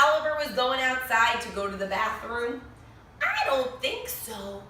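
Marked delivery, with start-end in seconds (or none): 3.2-4.6 playful high tease. Real, not acted. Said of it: "reader asks children playfully".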